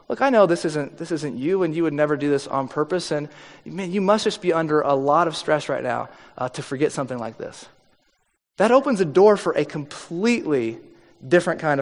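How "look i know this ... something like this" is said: softer and lower, giving the benefit of the doubt; reassuring. Retold or imitated. To the partner who forgot the bills, softly reassuring. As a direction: ease off and reassure them. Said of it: "Other options to say this".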